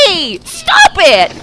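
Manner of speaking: high, slow, drawn-out cartoon squabble; Toonkind voice. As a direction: yell it like an indignant cartoon character. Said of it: "You hear a perfectly normal Toonkind voice go".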